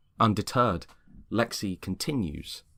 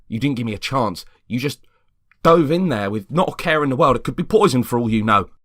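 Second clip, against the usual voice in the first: much louder, higher rising rant; heated scolding; 6 semitones higher, 10 dB louder than usual. Acted. James scolding Greg for grabbing a biscuit, heated. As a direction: build into an anxious telling-off. James justifies himself angrily.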